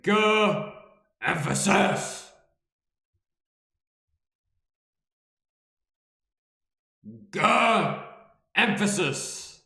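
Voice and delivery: high, forceful shouting with drawn-out words; loud emphasis. Shown as a demonstration. Taking add emphasis literally, loud emphatic shout. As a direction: belt it out with exaggerated attitude. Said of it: "add emphasis to say".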